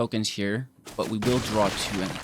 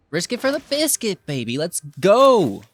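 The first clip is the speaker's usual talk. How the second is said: risk it, pumped and loud; high, thrilled shout; hyped up; 9 semitones higher, 9 dB louder than usual. Real, not acted. Big risky play.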